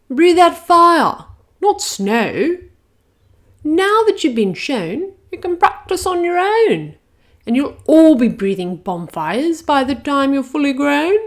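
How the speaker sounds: a grand schoolmistress dragon instructing her class; high loud dragon teacher voice; Madam Dragon. Acted.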